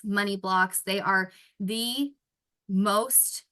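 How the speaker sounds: ordinary talk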